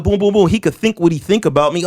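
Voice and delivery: ordinary talk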